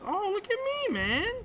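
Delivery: high, loud bragging squeal; gleeful brag. Real, not acted. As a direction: crow over your own shots. Bragging after good shots.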